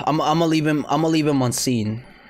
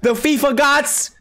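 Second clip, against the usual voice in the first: hyped plea; 11 semitones higher, slower than usual. Real, not acted. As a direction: beg the fifa gods with excitement. Pleads with the fifa gods.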